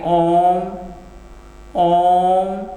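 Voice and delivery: ओम stretched long, held vowel; drawn-out Om. Shown as a demonstration. Teacher to students, showing pluta ओम held long. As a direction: hold the ओम long like a chant. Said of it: "ओम कहेंगे नहीं".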